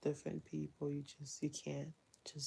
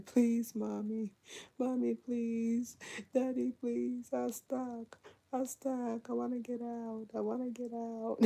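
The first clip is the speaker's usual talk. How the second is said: put-on high whining child, pleading to be let out; whiny child imitation; 8 semitones higher than usual. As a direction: play the stuck toddler begging, pitched up. Mom voices whining stuck daughter.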